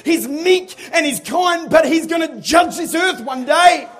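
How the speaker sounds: loud, high, shouting preacher building to a roar; full-force preaching. Acted. Pastor rousing his congregation, loud shouted preaching. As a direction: pray then preach it out at the top of your voice, rising.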